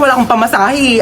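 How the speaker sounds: usual speaking voice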